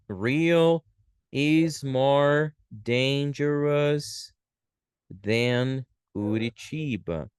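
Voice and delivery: very slow, stretched words with pauses; slow dictation. Shown as a demonstration. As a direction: dictate it word by word. Teacher writing out example sentence.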